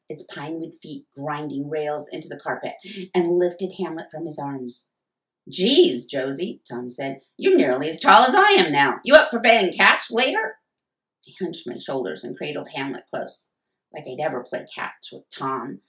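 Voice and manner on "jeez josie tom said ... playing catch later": a louder, cocky brother voice; a lightly voiced older brother, loud and joking; teasing big brother. A narrator's reading. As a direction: tease her like a big brother home from college. Brother Tom teasing sister.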